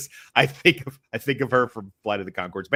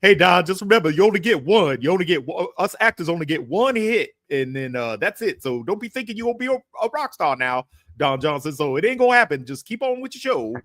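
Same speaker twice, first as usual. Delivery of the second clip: higher, louder comedian impression; Eddie Murphy; 5 semitones higher, 4 dB louder than usual. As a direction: rib a friend in a comic's raised voice. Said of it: "it's like Eddie Murphy was here".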